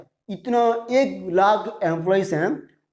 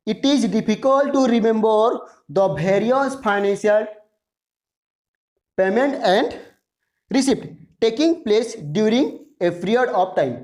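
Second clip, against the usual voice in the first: very slow, word-by-word dictation; drawn-out dictation with pauses between phrases; much slower than usual. Shown as a demonstration. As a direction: read slowly in short phrases for note-taking. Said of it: "मैं इसीलिए थोड़ा slowly बोल रहा हूँ".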